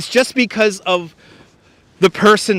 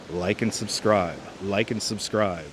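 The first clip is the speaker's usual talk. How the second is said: low, soft and slow suggestion; mock hypnotic; 8 semitones lower, 11 dB quieter than usual. Slipping a plug to a dozing viewer, hushed and slow. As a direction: murmur it slowly like a sleep suggestion.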